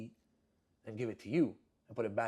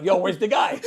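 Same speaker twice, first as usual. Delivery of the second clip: loud, high shout across the shop; full-volume comic bellow; yelling; 6 semitones higher, 12 dB louder than usual. Acted. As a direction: holler for someone out of sight. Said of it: "But I'm yelling".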